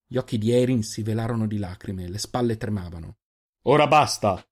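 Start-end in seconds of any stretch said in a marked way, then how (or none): 3.6-4.4 loud, raised outburst; angry shout. A narrator's reading. Giofix has heard enough of the accusations, angry shout. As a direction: put a stop to it with a shout. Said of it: "L'urlo di Giofix sembrò far tremare".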